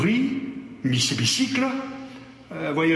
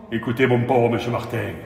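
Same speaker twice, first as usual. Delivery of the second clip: plain reading voice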